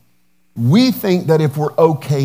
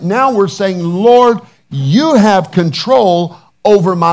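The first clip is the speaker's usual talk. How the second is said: fervent prayer; 2 semitones higher, 4 dB louder than usual. Acted.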